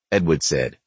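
ordinary delivery

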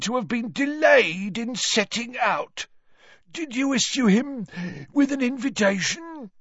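high, wheezy caretaker's report; Filch, gleefully accusing. Acted. Caretaker reports a caught boy to the professor, wheezy and gleeful. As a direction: denounce the culprit in a high wheeze.

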